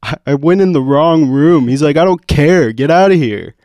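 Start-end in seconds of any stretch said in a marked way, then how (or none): 0.0-1.7 high, loud, stammering protest; exasperated, raised-voice self-defence; retold mad protest. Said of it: "I was so mad". 2.0-3.5 high, loud brush-off; heated retold shout; retold angry dismissal.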